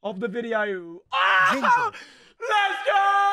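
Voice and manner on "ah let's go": excited. Real, not acted. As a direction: celebrate the pull at full volume. Card reveal celebration.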